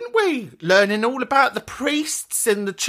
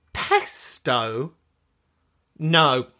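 disgusted disbelief; 6 semitones lower, much slower than usual. Real, not acted. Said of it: "I'm gonna need a minute".

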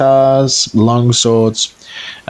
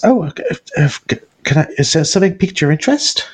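high, fluttery nervous shopkeeper voice; wiry woman; 6 semitones higher, faster than usual. Acted. Game master voicing the flustered smith's wife. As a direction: play her flustered and eager to please.

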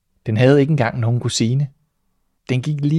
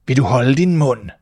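louder snapping shout; cat shouts; 2 semitones higher, 5 dB louder than usual. Acted. Cat silencing the mouse, shouting. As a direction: cut her off with a yell. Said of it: "råbte katten".

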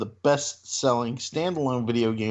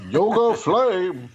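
loud, high fighting-game attack calls; yelled game moves; 6 semitones higher, 3 dB louder, slower than usual. Acted. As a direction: yell each special move, climbing higher. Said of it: "yelling the move you're doing".

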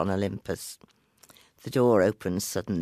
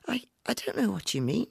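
frightened, breathless whisper, much higher; unsteady whisper; 12 semitones higher, faster than usual. Acted. Frightened girl whispers back to the old woman. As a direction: stammer it out in a shaky whisper. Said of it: "she said unsteadily".

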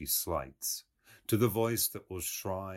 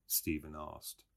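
ordinary delivery, nothing marked

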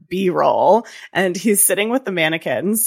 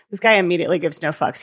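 usual speaking voice

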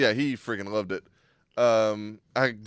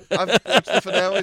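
laughing; 6 semitones higher, 9 dB louder than usual. Real, not acted. Laughing boast about the finale.

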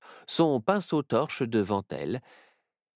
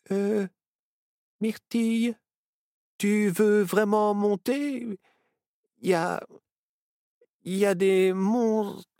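higher, slow and stammering; hesitant fear; 13 semitones higher, 4 dB louder, slower than usual. A narrator's reading. Nao, scared of the shadows, asks Myrtille to stop: higher, slow stammer. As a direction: hesitate at the bottom of the stairs.

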